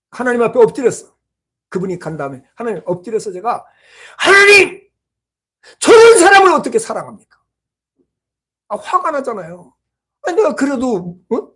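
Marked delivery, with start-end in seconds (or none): 4.2-7.4 loud, high cry of exasperation; angry protest. Retold or imitated. Preacher protesting to God about the elder, angry shout. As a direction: re-enact an outraged plea, pitched high. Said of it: "화를 내면서".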